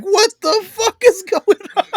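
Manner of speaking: very high, squeaky bewildered cry; reenacted freak-out in a high voice; retold panic. Retold panic as monsters chase friend.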